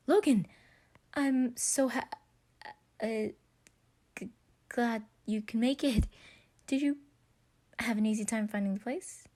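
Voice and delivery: excited, tongue-tied welcome; flustered excitement. Acted. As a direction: gush nervously at the door. Patton flustered greeting his crush.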